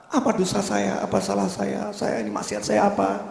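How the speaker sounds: understated retold crying; imitated tearful lament. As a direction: plead quietly as the distraught woman. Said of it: "Wanita ini nangis, nangis luar biasa".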